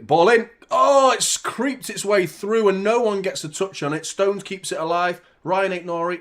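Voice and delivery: high, loud live football commentary; excited play-by-play. Real, not acted. Watchalong host calling live play to viewers, excited commentary. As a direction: burst into commentary, pitched well up.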